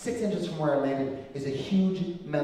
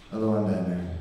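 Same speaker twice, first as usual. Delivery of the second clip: deflated Batman; 8 semitones lower, 4 dB quieter than usual. Retold or imitated. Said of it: "I had no gravitas".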